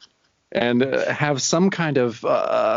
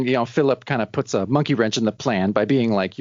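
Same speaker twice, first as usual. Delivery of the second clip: usual speaking voice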